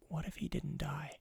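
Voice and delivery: hushed conspiratorial whisper. Acted. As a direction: breathe it like a secret. Dramatic theory reveal.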